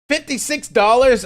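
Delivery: a loud, outraged "how much?!"; shocked at the price. Real, not acted. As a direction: jump way up high and loud in disbelief.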